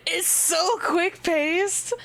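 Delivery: high, slow, gushing "quick-paced"; excited. Real, not acted. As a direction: marvel at it. Excited about the scene.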